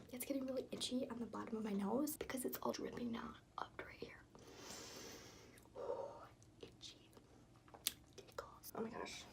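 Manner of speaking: soft unvoiced whisper, breathy and hushed; whispery voice. Real, not acted. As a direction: whisper it like a calm bath vibe. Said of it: "why I'm talking in, like, a whispery voice".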